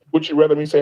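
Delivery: ordinary talk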